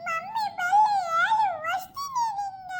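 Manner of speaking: crying. Acted. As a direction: wail to auntie like a hurt child. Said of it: "ಮೊದ್ಲೇ ಅಳ್ತಿದ್ದಾಳೆ". (ಜೋರಾಗಿ ಅಳುತ್ತಾ)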